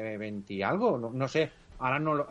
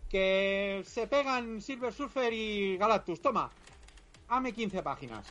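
high, eager mock Stan Lee; imitating Stan Lee; 7 semitones higher, slower than usual. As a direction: pitch it up as a pushy boss. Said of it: "Se lo sacó".

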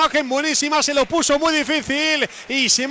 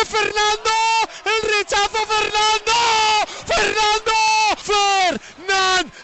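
much higher, crying out the keeper's name; penalty save scream; 7 semitones higher, slower than usual. Real, not acted. Penalty save called live.

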